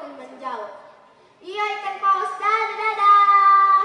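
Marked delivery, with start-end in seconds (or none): 1.4-3.8 loud goodbye. Acted. Child waving goodbye.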